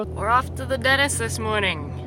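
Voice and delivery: ordinary delivery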